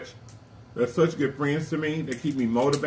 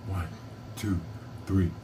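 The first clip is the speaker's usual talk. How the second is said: normal talking voice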